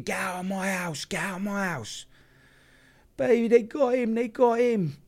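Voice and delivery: very high, strained mock scream; imitated screaming. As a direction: imitate a frantic mother screaming down the phone. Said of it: "And she's screaming".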